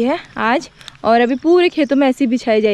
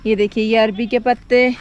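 usual speaking voice

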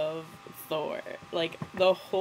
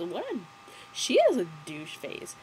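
ordinary talk

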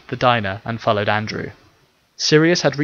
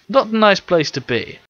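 chiding; 9 semitones higher, 5 dB louder than usual. Acted. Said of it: "Owen chided in".

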